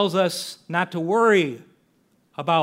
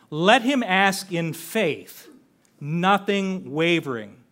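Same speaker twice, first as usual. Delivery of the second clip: slow, weighty reading of each phrase; slow emphatic scripture; 3 dB louder, slower than usual. Acted. Preacher quoting James slowly to his congregation, weighty and deliberate. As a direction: lay every word down slowly.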